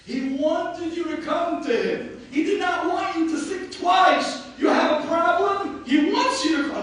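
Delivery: high, loud fervent preaching; preaching at full force. Acted. Rabbi preaching loudly to the mourners at a eulogy. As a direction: cry it out to the mourners.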